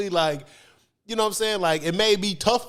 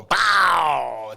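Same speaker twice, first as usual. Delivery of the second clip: imitated TV crash; 3 semitones lower, 3 dB louder than usual.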